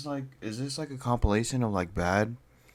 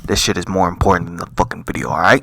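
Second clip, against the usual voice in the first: annoyed scolding; 6 semitones lower, 7 dB louder than usual. Real, not acted. Reactor scolding the viewers.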